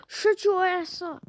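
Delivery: sharp high yell after a gasp; startled, snapping at a teammate; yelled shut up. Real, not acted. Gamer snaps at a chatty teammate, loud yell.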